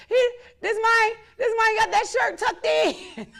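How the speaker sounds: loud, high, laughing through the words. Real, not acted. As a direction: shout it out while cracking up. Mocking rival's outfit, cracking up.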